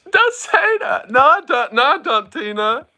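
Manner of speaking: flustered pleading. Real, not acted. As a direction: beg her to stop, flustered. Embarrassed pleading at chat.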